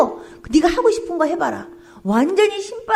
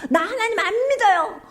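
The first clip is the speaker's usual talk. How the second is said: mock defiance; 5 semitones higher than usual. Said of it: "이러면서요".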